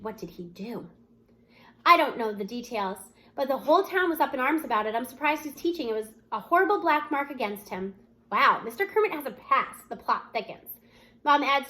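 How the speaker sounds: ordinary delivery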